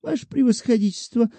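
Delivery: put-on coquettish old woman. A narrator's reading. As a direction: ask sweetly with a coy smile. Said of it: "кокетливую улыбочку".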